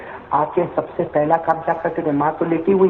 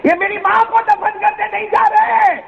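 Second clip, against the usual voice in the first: climbs higher and higher into a wailing shout; grief-stricken outcry. Retold or imitated. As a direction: build from sobbing lament to a full cry. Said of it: "کہا". (غم سے چیختی ہوئی بلند ہوتی پکار)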